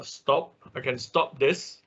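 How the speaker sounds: ordinary talk, nothing marked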